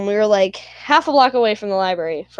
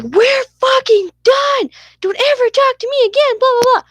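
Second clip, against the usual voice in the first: imitated angry yell; 5 dB louder than usual. Austin yelling at Melissa on the street, imitated furious yell. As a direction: yell it high and loud, imitating a furious kid. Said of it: "literally what this fucker yelled".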